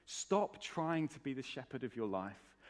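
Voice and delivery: ordinary talk, nothing marked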